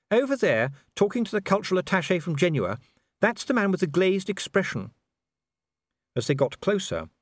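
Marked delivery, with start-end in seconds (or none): 0.1-4.9 higher, refined lady; a genteel lady, lightly amused; Sybil voice. A narrator's reading. Sybil speaking.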